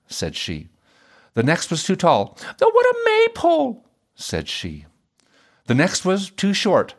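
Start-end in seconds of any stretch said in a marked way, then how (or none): 2.6-3.9 high-pitched exclamation of scorn; mocking princess. A narrator's reading. Princess jeering at a too-tall suitor, mocking. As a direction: poke fun in a very high princess voice.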